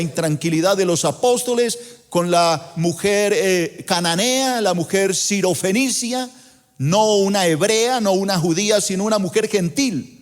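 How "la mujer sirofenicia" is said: usual speaking voice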